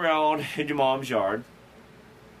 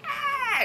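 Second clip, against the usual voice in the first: a much higher hammed-up movie shriek; mock horror scream. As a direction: fake a horror-film scream, way up high. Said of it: "blood-curdling horror movie scream".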